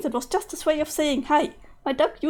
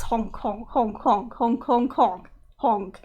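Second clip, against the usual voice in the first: clown honks; slower than usual. Acted. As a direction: honk like a clown horn. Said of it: "nothing but honks".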